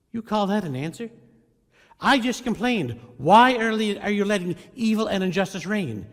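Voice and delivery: high, loud incredulous protest; stunned indignation. Retold or imitated. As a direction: throw it back in disbelief. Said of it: "Habakkuk is stunned".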